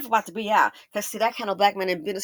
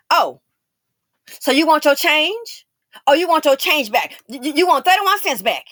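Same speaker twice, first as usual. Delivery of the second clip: loud, higher mock of a snide cashier; tacky cashier; 7 semitones higher, 10 dB louder than usual. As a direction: sneer it back like she's shaming me. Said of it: "she said it real tacky".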